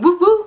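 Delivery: high, loud imitated whooping. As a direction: call out two loud whoops. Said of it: "like, really loud".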